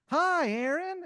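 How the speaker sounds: very high, chirpy cartoon bird greeting; high sing-song hello from cartoon birds; squeaky cartoon birds. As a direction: chirp it like a flock of tiny birds.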